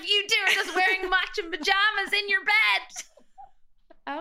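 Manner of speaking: mock bitchy mom. Mimicking mom nagging the girls about their pajamas, bitchy. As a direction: lay into the girls like a nagging mother. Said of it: "Okay, Mom, bit bitchy".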